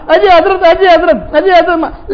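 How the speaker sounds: high, pleading repeated call; imploring cry of the companions. Retold or imitated. People clinging, begging him to stay.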